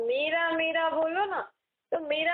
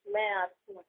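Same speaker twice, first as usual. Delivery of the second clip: inner whisper; 6 semitones lower than usual. Shown as a demonstration. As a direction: murmur it to yourself as the soul. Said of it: "धीरे बोलना".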